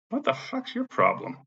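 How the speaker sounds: high, drawn-out exasperated comeback; retold indignant retort. Retelling his indignant retort to her.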